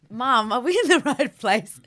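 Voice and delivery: half-laughing mock panic; freaking out. Teen asking mum at the empty venue, freaking out. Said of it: "We were freaking out".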